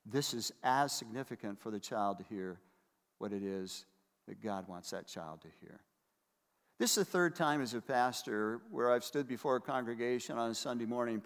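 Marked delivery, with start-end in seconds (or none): 1.6-5.8 hushed. Real, not acted. Sermon drops to hush.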